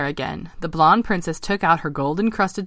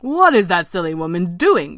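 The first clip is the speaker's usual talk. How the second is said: louder, mildly indignant frog; a touch of exasperated frog; croaking frog; 2 semitones higher, 5 dB louder than usual. Acted. Ken puzzled by the princess's ball throwing, croaking. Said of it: "croaked Ken".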